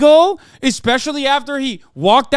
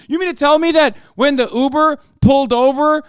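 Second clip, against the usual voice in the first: ordinary delivery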